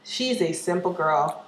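usual speaking voice

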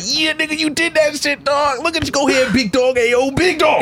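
hype-man voice. Retold or imitated. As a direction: pump yourself up in the mirror. Hyping himself in mirror.

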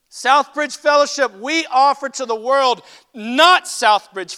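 loud, driving preacher's call; fiery preaching. Real, not acted. Pastor rebuking his own church, loud fiery preaching. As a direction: hammer it home to the congregation.

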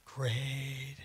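soft, flat "Great."; deadpan dread. Retold or imitated. New addict answering the supervisor, soft and deadpan. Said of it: "I was like".

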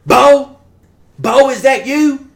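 put-on old neighbor lady calling up the stairs for Bo. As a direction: call out from the doorway like an old lady looking for someone.

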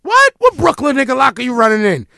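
high, loud startled What; shocked question. Retold or imitated. His own shocked reply to the bunkmate, loud and high. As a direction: hit What with alarm. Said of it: "And I'm like".

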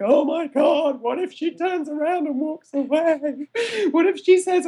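high-pitched comic fretting; hammed-up anxious what-ifs; mock inner panic. Acts out hidden inner panic.

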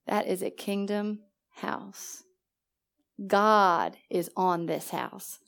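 slow, soft and reverent, as if revealing a holy secret; hushed awe. Retold or imitated. A stranger quietly tells her God is on her house, slow and hushed. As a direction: hush it, slowly. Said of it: "He said to me".

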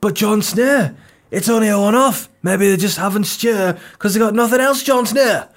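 high-pitched put-on accent, mock objection; protesting Game of Thrones character. People object to Jon Snow about the stew, high mock-protesting voice. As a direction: protest in a high, hammed-up fantasy voice. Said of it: "people might be like".

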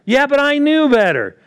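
high, loud protesting excuse; mock excuse. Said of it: "voicing a believer's excuse".

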